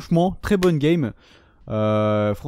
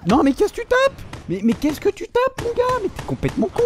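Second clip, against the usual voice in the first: outraged disbelief. Real, not acted. Gamer yelling at an enemy player, outraged and high. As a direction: shriek at the player who shot you.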